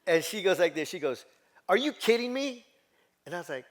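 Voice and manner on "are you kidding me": high, louder cry of disbelief; mother on the phone, stunned son never listened, high incredulous yell; mock stunned yell. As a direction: retell mom's outraged disbelief.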